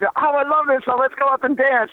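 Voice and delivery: high-pitched gush of delight; excited fan. Retold or imitated. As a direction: squeal like a thrilled fan at a party. Acts out crowd hearing the song.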